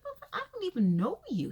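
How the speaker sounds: high, fast, hammed-up snap at the father; mock outburst cursing out the dad; imitating a son telling off his absent father; exaggerated angry retort. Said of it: "He didn't say it like that".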